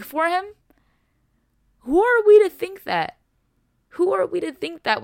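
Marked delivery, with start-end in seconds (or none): 1.8-3.2 higher and louder, demanding the answer; emphatic outcry. Real, not acted. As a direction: throw the question out like a challenge. Preacher's outraged rhetorical question.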